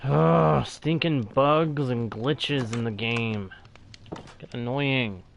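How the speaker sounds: normal talking voice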